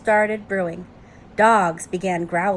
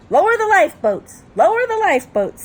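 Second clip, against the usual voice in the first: high, loud parrot alarm call; squawking parrot; 9 semitones higher, 7 dB louder than usual. Acted. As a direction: squawk orders like a panicking parrot. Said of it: "squawked the parrot".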